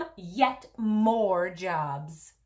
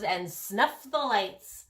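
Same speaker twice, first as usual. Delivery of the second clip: plain reading voice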